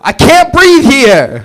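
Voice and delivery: put-on brash New York accent shout. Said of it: "if he was from New York".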